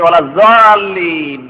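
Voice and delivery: "জালিন" with a z sound, vowels stretched long; mistaken z pronunciation. Retold or imitated. Teacher mimicking readers who make dad into za, stretched "জালিন". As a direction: recite "ওয়ালা জালিন" with a long, loud z-like dad. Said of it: "জিমের মতো বা জায়ের মতো যদি বানিয়ে দেন".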